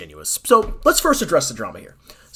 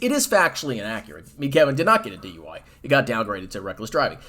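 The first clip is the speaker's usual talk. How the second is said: ordinary talk, nothing marked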